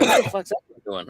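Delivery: high, puzzled mock voice of the installers. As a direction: play the baffled installers watching him. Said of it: "they're like".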